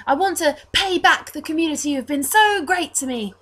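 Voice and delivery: put-on high, earnest, chatty boy; Reese. Orphan altar boy explaining his gratitude, high earnest voice. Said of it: "twelve-year-old-ish looking blonde altar boy".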